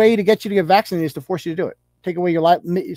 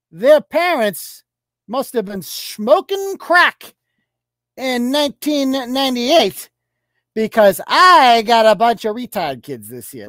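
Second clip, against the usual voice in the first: higher, slow, hammed-up whiny teacher; mock ranting teacher; 4 semitones higher, slower than usual. Pretending to be a teacher posting about students, hammed-up. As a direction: play a teacher whining about the kids. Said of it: "Imagine I wrote that as a teacher".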